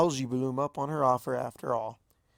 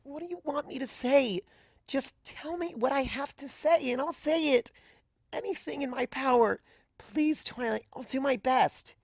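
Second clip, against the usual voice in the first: put-on high, pleading young mare voice; plead, eager to say anything; desperate Azalea; 12 semitones higher than usual. A narrator's reading. Azalea begs Twilight what to say, high and desperate.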